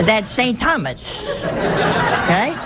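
giggling through the words as it comes back; amused laugh of sudden recall. Real, not acted. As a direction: laugh out the name, delighted. Laughing as she remembers.

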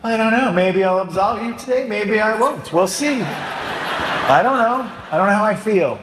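high, hesitant, laughing mock indecision; mock wishy-washy priest. Priest mocking a vague penitent, high and laughing. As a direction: tease them with a dithering priest voice. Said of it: "What if I said".

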